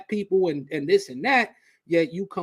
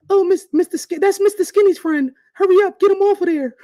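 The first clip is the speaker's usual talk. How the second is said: high, loud squeaky panicked voice; mock squeal of a panicking friend; mocking friend's panic; 14 semitones higher, 9 dB louder than usual. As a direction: imitate a friend freaking out. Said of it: "And then D going".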